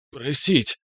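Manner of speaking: choked gurgle, barely able to speak; gurgling. A narrator's reading. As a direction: squeeze it out through a gripped throat. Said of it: "пробулькал я, едва имея возможность говорить". (булькающий сдавленный голос)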